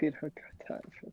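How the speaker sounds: unvoiced whispered repeat; whispered echo. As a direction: whisper it back teasingly. Mocking echo of co-host.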